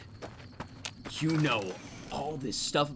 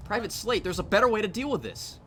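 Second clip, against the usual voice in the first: louder, higher pleading; 10 semitones higher, 7 dB louder than usual. Pretend. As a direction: plead urgently to drop it. Talking down armed player character.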